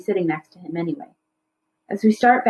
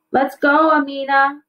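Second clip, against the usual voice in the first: higher, louder call from the garage; 5 semitones higher, 3 dB louder, slower than usual. A narrator's reading. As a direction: call her to hurry up. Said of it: "Baba calls".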